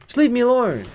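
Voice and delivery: mock exasperation. Ending the plane story to viewers, hammed-up fed-up plea. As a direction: pretend pleading to be left alone.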